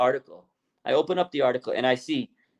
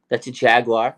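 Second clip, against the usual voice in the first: normal talking voice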